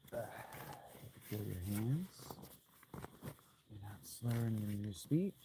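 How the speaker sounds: soft, fading reassurance; calm yourself down quietly; self-soothing. Pretend. Calming himself in the cold.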